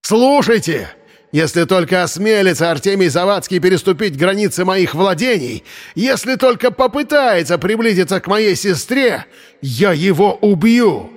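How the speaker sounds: high, ringing proclamation of a threat; shouted threat. A narrator's reading. Brother warns everyone off his sister, high shouted threat. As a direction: declaim it high, stretching the threat at its peak. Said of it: "Он повысил голос до крика".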